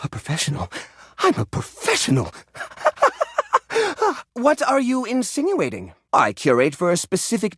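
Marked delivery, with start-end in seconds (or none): 2.6-4.3 high nervous titter; nervous laugh. Acted. Collector laughs off being called unprofessional, nervous titter. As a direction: laugh off the accusation.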